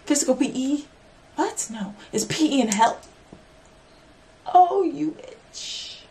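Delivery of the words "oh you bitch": slow, strained cry of sudden pain; real pain cry. Real, not acted. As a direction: yelp through real pain. Breaks her nail mid-talk.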